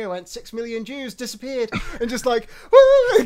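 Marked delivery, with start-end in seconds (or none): 0.3-2.5 loud, high whoop with laughter; cheeky retold joke, loud and high with a laugh; mock cheer. 2.7-3.2 loud, very high whoop; gleeful whoop after a dark joke; mock whoop.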